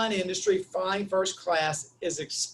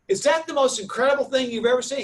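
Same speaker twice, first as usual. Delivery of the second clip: amazed; 6 semitones higher, 6 dB louder than usual. Retold or imitated. Amazed at the contractor's follow-up.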